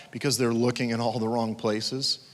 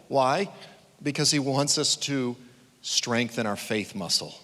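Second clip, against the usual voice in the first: normal talking voice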